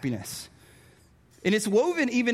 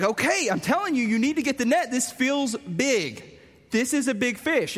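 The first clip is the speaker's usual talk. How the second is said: higher, urgent insisting; insistent kid; 5 semitones higher than usual. Retold or imitated. Kid insisting to dad about the big fish, urgent. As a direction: push Dad to take you seriously.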